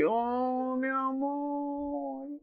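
high, soft, very slow swoon; swooning sigh. Real, not acted. Fan swooning at the couple, high, soft and slow. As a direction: melt over the sweet line.

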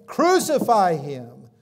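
high, loud shout, slowing at the end; crying out. Acted. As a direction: yell it like a furious crowd. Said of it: "they cried out".

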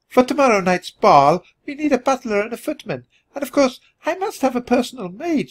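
very high, chattering lady mouse; squeaky Countess. A narrator's reading. Countess chattering about staff for the ball, squeaky. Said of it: "she squeaked".

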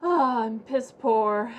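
slow, loud put-on whine; mock pauper voice. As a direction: ham up being broke. Mock poor character voice.

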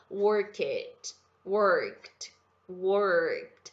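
"worked" as one syllable ending in a t, repeated slowly; "worked" said slowly with a final t, no e-d syllable; correct worked. Shown as a demonstration. Teacher drilling students on the right "worked", slowly. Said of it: "a gente fala".

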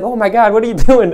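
amused disbelief. Retold or imitated. Shocked salesman reacts to dry firing.

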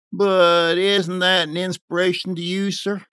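exclaiming. A narrator's reading. Visionary youth appealing to the complainer, exclaiming. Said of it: "he exclaimed".

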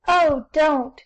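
loud, high distressed cry. A narrator's reading. The hostess begging the rude guests to stop, loud and distressed. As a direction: cry out in dismay at the laughing boys. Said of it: "cried Mrs. Smith, much distressed".

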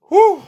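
high, loud relieved whew. Real, not acted. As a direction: blow out relief loudly. Relief after good pull.

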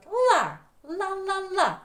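ла on a high, punched pitch; syllable sounded out. Shown as a demonstration. Teacher sounds out the second syllable, ла high and repeated. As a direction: repeat ла high, first one loudest. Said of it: "Тепер другий склад".

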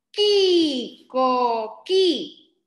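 "coqui" sounded out CO-KI, drawn out; loud, stretched "qui", then "co-qui" as KO-KI; silent U. Shown as a demonstration. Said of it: "No decimos la U aquí".

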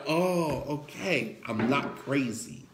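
low, soft realization; relieved parent settling down, softly; acted relief. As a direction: let the worry drop away, quietly. Parent relieved on hearing another's story.

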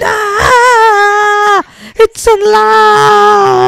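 very high, loud, drawn-out shriek; comic horror-film scream; screaming in shock. Acted. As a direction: scream in horror, holding the last word. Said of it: "she screamed".

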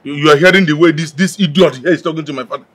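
low, loud indignant accusation; angry indignation. Acted. Relative protesting an insult to a father, angry indignation.

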